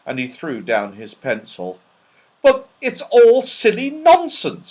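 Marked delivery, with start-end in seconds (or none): 2.4-4.7 Joe, indignant. A narrator's reading. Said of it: "said Joe".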